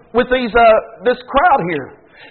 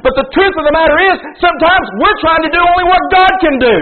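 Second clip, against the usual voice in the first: raised, higher preaching drive; 8 semitones higher than usual. Acted. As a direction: push the point home from the pulpit. Preacher building to full force.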